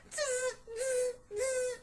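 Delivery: high squeaky monkey chatter; mock him like cheeky monkeys. A narrator's reading. Monkeys mocking the peddler with high chattering. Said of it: "the monkeys ... said".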